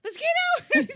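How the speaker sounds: very high, loud mock-panic squeal; hammed-up child's alarm; imitated panicked child. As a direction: squeal it like a scared little kid. Imitating frightened son seeing mosquito.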